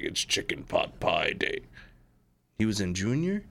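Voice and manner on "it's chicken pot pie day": low, slow booming cartoon character; deep cartoon voice. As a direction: drop it low and slow like the voice actor. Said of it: "where he was like".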